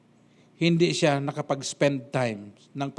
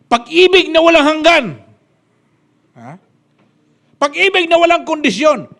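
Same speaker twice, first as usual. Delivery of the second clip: much higher, louder proclamation; shouted preaching; 11 semitones higher, 11 dB louder than usual. Acted. Preacher crying out about unconditional love, much higher and louder. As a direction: cry it out to the rafters.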